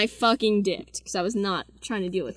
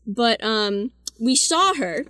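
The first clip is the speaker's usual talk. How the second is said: usual speaking voice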